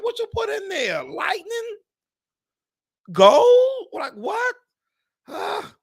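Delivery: very high, shrill disbelief to the mechanic; outraged disbelief. Retold or imitated. Asking the mechanic about the oil change price, shrill outrage. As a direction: squeal it in outrage. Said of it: "I said".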